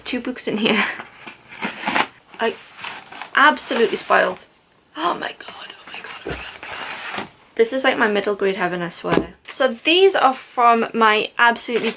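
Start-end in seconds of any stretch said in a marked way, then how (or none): 5.0-7.3 breathless, whispered oh my god, over and over; awed whisper. Real, not acted. Whispering to herself while unwrapping book gifts, awed. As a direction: whisper it like you can't believe your eyes.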